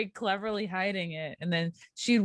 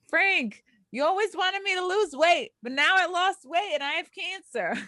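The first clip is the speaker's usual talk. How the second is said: joking wife voice; 9 semitones higher than usual. Retold or imitated. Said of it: "she was like".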